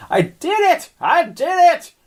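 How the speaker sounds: high shrieking witch cry; old hag. Acted. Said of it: "Pickle the Witch was shouting".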